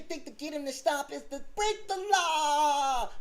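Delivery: mocking mimicry. Mimicking the rival's excuse to break the law, mocking high cry. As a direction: mimic him in a rising, whiny wail.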